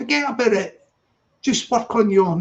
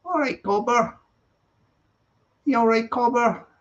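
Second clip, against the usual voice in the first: a light put-on Aussie accent, pitched a bit higher; mock Aussie accent; 5 semitones higher than usual. Streamer imitates an Aussie viewer, mock Aussie accent. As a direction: greet a mate in a playful Aussie accent. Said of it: "you might have one of them Aussie accents".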